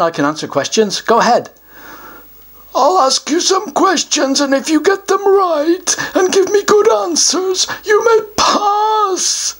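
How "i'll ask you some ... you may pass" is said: pompous, higher troll voice; a self-important troll puffing himself up; troll guardian. Acted. Troll announces he guards the treasure, pompous higher voice.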